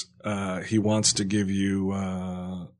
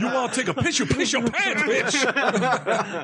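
high, hammed-up threat over laughter; mock angry orderly; 14 semitones higher, slower than usual. Imagined orderly threatening a patient, mock angry voice. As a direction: play a bullying comeback in a pitched-up voice.

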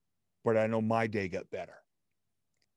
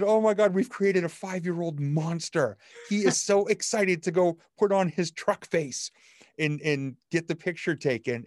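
high, loud, amused exclaiming; excited exclaiming; 8 semitones higher, 4 dB louder than usual. Retold or imitated. Wife laughing about her childlike husband, high and loud. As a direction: gush with delighted disbelief. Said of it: "And she said".

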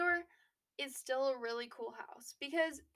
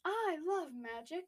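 put-on slow, naive boy voice; Harry impression; 5 semitones higher, slower than usual. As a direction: gush it like an amazed kid. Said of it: "That was Harry in number four".

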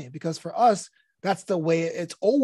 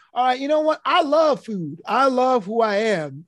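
higher, playful, slowing into self-love; self-mocking comic; 5 semitones higher, 3 dB louder than usual. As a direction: own it like a fat joke punchline. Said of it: "lean into it and go".

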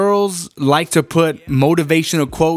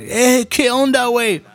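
much higher, mocking Mexican Spanish accent; mock Mexican Spanish greeting; 9 semitones higher than usual. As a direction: tease them with a high put-on Mexican Spanish greeting. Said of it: "they're like".

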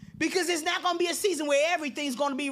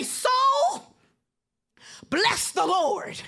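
commanding your soul; 5 semitones higher, 4 dB louder, much slower than usual. Acted. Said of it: "you have to say".

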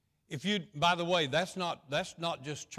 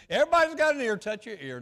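mock call out; 7 semitones higher, 9 dB louder, faster than usual.

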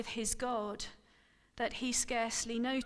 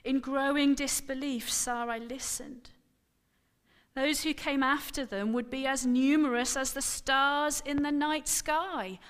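plain reading voice

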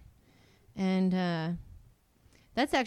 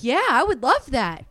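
loud, higher mock enthusiasm; eager customer; 4 semitones higher, 10 dB louder than usual. Customer falling for a pointless sales pitch, over-keen. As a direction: gush like a sold customer.

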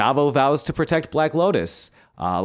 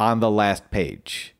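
ordinary delivery

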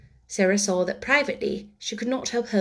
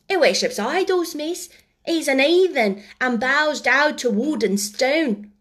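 higher, louder cockney-style servant voice; higher-pitched gossiping maid; earnest servant girl; 3 semitones higher, 4 dB louder than usual. Acted. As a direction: chatter indignantly as a young maid. Voices servant girl Becky's speech.

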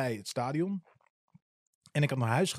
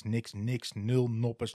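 usual speaking voice

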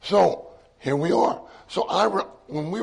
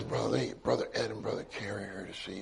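much softer, lower, close to his ear; retold whisper; 6 semitones lower, 11 dB quieter than usual. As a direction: murmur it gently into a sick man's ear. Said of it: "Carrie whispers in his ear".

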